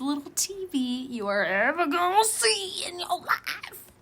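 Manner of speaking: very high, drawn-out mock squeal; gushing hype. Hyping the cutest little TV to viewers, gushing squeal.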